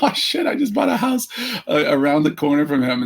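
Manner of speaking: sudden alarm at buying a house next to Steve; shocked realization. Retold or imitated. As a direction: pitch jumps high on oh shit, then settles. Realizing he's a neighbour.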